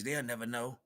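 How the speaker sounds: ordinary talk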